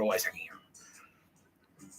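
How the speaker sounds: drawn-out whisper, slowed way down. Real, not acted. Confiding about his invisible friends, in a slow whisper. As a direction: breathe it slow like a secret. Soft aside about the babes.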